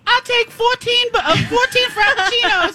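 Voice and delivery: high mock teen voice ordering; squeaky mock teen rattling off a big order; mocking teenager customer. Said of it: "forty teenagers come in and go".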